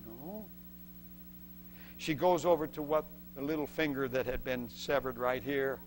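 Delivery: ordinary talk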